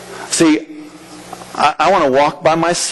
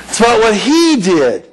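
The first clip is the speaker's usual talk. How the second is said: normal talking voice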